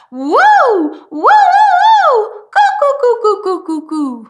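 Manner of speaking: high, loud head-voice woo and coo sliding down; head voice demo. Shown as a demonstration. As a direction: lift it up into the head. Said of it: "They sound very different".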